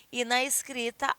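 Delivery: ordinary talk, nothing marked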